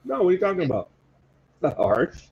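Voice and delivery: ordinary talk, nothing marked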